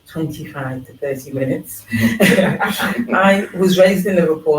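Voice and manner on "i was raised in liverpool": laughing. Real, not acted. Laughs while introducing herself.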